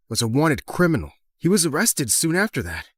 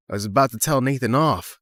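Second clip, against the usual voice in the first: ordinary delivery, nothing marked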